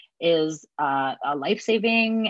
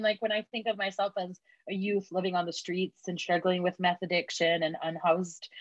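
usual speaking voice

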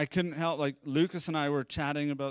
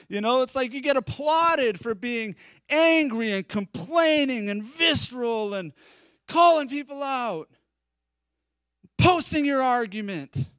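getting worked up; 6 semitones higher, 4 dB louder than usual. Real, not acted. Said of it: "I'm getting a little visceral now".

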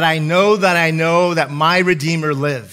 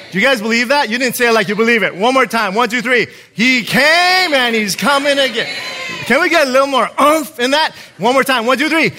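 hyped-up rallying call; 6 semitones higher, 4 dB louder, faster than usual. Real, not acted. Rallying congregation for louder response.

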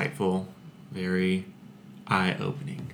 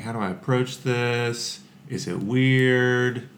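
higher voice, drawing out "weird"; retold fretting; 4 semitones higher, slower than usual. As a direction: mull it over anxiously, lightly acted. Acts out worried inner thoughts.

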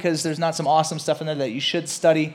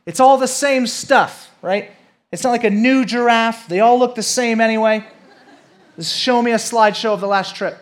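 put-on high, loud complaining child; a boy arguing with his parents, loud childish whine; whiny kid; 8 semitones higher, 8 dB louder than usual. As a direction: keep whining like a stubborn kid. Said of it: "And I said".

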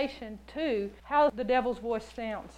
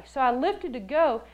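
ordinary talk, nothing marked

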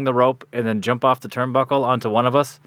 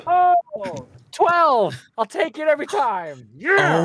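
very high thrilled cry; real excitement over the dice; excited; much slower than usual. Real, not acted. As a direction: celebrate a great roll. Rolls a twelve.

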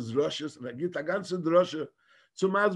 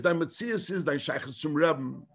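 usual speaking voice

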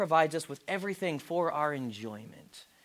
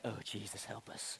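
despairing mock whisper; 10 semitones lower, 19 dB quieter than usual. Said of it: "and you're like".

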